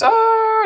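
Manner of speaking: high, drawn-out playful "aah"; mock charge cry. Player charges Ravager into both tanks, mock charge cry. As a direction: let out a high pretend yell.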